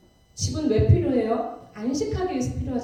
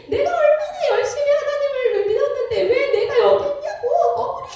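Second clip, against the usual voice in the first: much higher and louder, protesting outrage; indignant screaming; 11 semitones higher, 4 dB louder than usual. Retold or imitated. Deacon in hell protesting to everyone, high angry shouting. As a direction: yell that it's unfair and you don't belong here. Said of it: "막 소리를 지르는 거예요".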